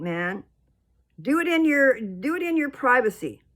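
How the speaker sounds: a preacher's angry rebuke at the screen; heated scolding. Real, not acted. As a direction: tell them off, fed up and loud. Ranting at people showing skin.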